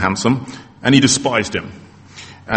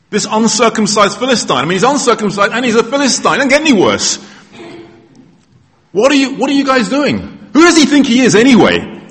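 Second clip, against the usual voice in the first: high, outraged disbelief, building louder; indignant young David; 10 semitones higher, 4 dB louder than usual. Acted. Young David rails at soldiers about the Philistine, high indignant voice. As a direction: scoff at the giant in a raised, high pitch. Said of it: "asks David".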